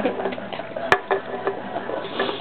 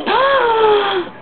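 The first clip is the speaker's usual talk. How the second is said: high, sharp gasp; hammed-up gasp of shock; 9 semitones higher, 4 dB louder than usual. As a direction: suck in air in mock shock. Gasp at the betrayal.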